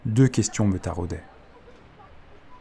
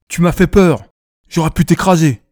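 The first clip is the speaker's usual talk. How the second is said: much higher and louder, shaken shout; startled driver; 8 semitones higher, 10 dB louder than usual. A narrator's reading. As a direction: yell from the truck window after a near miss. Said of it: "me lança le chauffeur".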